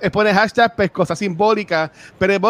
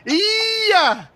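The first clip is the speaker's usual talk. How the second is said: excited cheer; 10 semitones higher than usual. Real, not acted. Cheering a donation.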